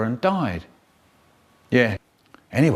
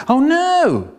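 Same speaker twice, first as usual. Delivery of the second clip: very high, drawn-out dismay; hammed-up high cry of alarm; horrified cry; 13 semitones higher, 4 dB louder than usual. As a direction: wail it in mock horror.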